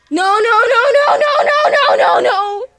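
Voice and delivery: rapid cry of no, rising high and loud; distressed pleading. Real, not acted. Upset reaction to episode.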